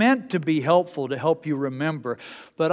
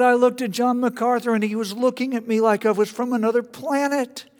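much higher and louder animated preacher voice; John Piper voice; 8 semitones higher, 4 dB louder than usual. Retold or imitated. Piper remembering his friendship with MacArthur, high and eager. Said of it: "he's got a high-pitched voice".

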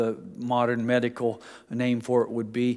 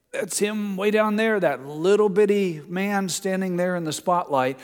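higher, stretched-out pointing from afar; high-pitched spotting; 6 semitones higher than usual. Retold or imitated. Recalling the last crusade from a far seat, high-pitched spotting. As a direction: pick out a tiny distant figure, pitched up.

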